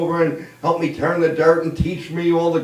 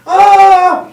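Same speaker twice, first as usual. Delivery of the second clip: loud, high comic scream; mock falling scream; 9 dB louder than usual. Irishman jumps off the bridge, hammed-up scream.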